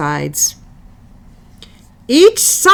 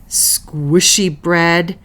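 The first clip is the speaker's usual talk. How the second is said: usual speaking voice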